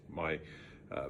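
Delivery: usual speaking voice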